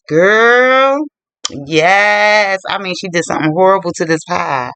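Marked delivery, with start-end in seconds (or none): 0.1-2.6 drawn-out, higher gossipy exclamation; scandalized relish. Real, not acted. Storyteller hinting at the horrible pie, gleefully scandalized. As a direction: stretch it out like juicy gossip.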